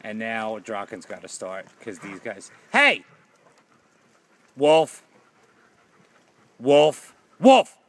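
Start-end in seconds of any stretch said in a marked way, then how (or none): 2.7-3.0 high, loud warning shout; sharp shout. Real, not acted. Owner interrupting misbehaving dogs, shouting. 4.6-7.8 calling out. Real, not acted. Owner calling Wolf off, loud and mounting.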